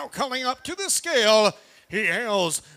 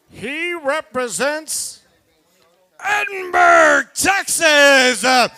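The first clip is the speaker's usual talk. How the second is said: drawn-out, loud fighter introduction; ring announcer call; 6 semitones higher, 7 dB louder, slower than usual. Real, not acted. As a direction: boom out the hometown and name.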